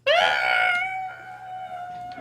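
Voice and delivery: high squealing laugh; real delighted high laugh; excited laughing shriek. Real, not acted. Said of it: "I'm geeking out".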